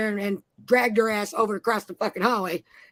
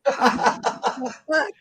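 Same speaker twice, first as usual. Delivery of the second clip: laughing; 3 semitones higher than usual. Real, not acted. Laughing at own story.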